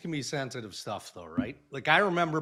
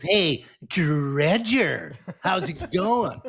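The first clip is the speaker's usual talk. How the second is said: high, drawn-out goading call; taunting rival; 4 semitones higher, 5 dB louder, much slower than usual. Retold or imitated. Rival walking behind calls out the wrong name, taunting. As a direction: needle him with a stretched, sing-out greeting. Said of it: "my rival. He goes".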